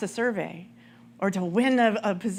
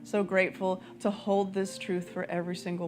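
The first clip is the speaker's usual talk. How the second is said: guided prayer; 2 semitones lower, 4 dB quieter than usual. Acted. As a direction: guide the room into prayer, unhurried. Leads prayer over music.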